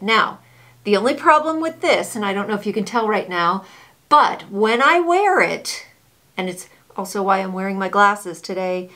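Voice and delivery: muffled behind face covering; speaking through fabric mask; through a cloth mask. Real, not acted.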